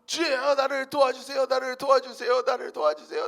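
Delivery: high, loud rebuke fading into pleas; full-throated cry winding down; furious shout. Retold or imitated. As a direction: bellow at Satan, then beg for help. Man rebuking Satan and pleading.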